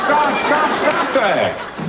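high firm stop; stern. Acted. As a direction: cut them off firmly. Doctor halts bullying.

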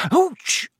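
sharp high yelp of pain; sudden high ouch of pain; pained gasp. Acted. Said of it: "gasped Hermione".

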